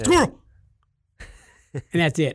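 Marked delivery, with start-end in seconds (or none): 0.0-0.4 quoting Up dog. Retold or imitated. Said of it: "the dog from the movie Up".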